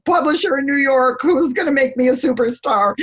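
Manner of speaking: mock rant at God. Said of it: "cursing out God".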